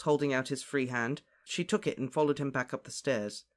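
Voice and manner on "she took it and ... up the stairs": plain reading voice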